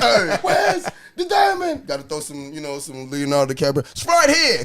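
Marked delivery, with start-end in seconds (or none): 0.0-1.9 slow, high, drawn-out laughing cry; slowed imitation of the movie line; slow mock movie cry. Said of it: "repeating movie line".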